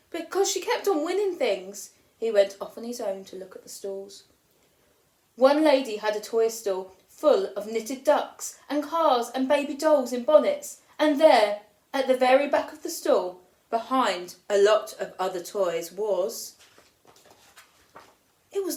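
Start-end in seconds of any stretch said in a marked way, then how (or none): none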